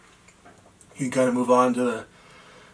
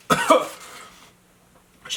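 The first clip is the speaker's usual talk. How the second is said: slow, strained, coughing through the burn; strained from spicy heat. Real, not acted. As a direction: choke the words out through the heat. Coughing after eating ghost pepper chocolate.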